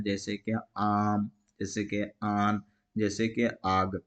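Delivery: आम, आन, आग with the आ drawn out slowly; teacher stretching the vowel; stretched long a. Shown as a demonstration. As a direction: pull the long a in each example, slowly. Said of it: "उसे खींचकर पढ़ा जाता है".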